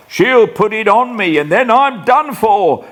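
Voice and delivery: high, panicked pleading; frantic raving. Acted. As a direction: rave at the unseen ghost. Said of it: "Next he cries".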